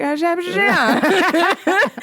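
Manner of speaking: high, laughing Australian mimicry; Australian accent. Friend echoes Australian lady without meaning to, Australian accent. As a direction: copy an Australian greeting, cracking up. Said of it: "thought I was mocking her".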